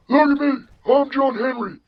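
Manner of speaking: mocking high-pitched old-man impression; John Henry. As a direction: mimic him in an exaggerated falsetto. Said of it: "doing an impression of John Henry".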